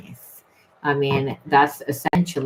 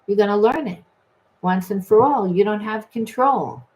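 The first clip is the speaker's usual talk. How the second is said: Spirit laying down the law; 6 semitones higher than usual. Retold or imitated. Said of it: "Spirit says".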